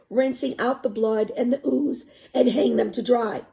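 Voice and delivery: expressive read-aloud narration; story narration. A narrator's reading. Teacher reading a novel to students, expressive narration.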